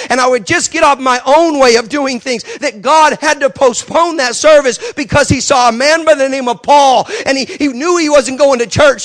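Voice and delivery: loud, high, building sermon climax; preaching at full force. Acted. Preacher driving home the lesson to the congregation, fervent. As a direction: preach it to the back row.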